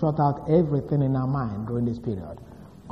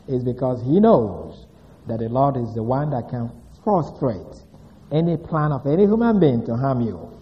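normal talking voice

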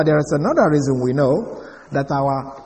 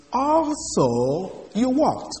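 slow, even literal rendering of "Also you walked."; slow literal reading; 7 semitones higher, 4 dB quieter, much slower than usual. Shown as a demonstration. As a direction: read "Also you walked." slowly, without extra emphasis. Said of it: "literally from the Greek means".

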